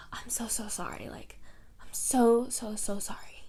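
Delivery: hammed-up whispered apology like a bad actor; mock-sincere whisper, imitating the boyfriend; dramatic whisper. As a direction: breathe it out as a fake, theatrical sorry. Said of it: "That's how he says it".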